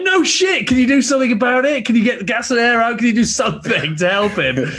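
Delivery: very high, loud desperate plea; frantic shout. Retold or imitated. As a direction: beg them loudly to help, voice cracking high. Retold pleading with medics.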